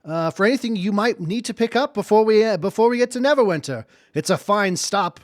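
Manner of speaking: announcer voice. Acted. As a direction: call out the next stop like a conductor. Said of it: "hearing the announcement".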